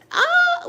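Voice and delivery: high mock scream of fright; demo scream. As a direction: shriek it like you're panicking over a wasp. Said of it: "like that".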